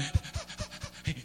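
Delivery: fast, voiceless excited panting; dog panting. Acted. Preacher imitates a dog greeting its owner, panting. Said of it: "He pants".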